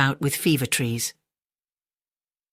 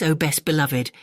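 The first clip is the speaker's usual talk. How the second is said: usual speaking voice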